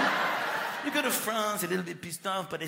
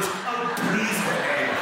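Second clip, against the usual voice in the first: mock scandalised; 3 semitones higher, 9 dB louder, much slower than usual. Scandalised reaction to innuendo.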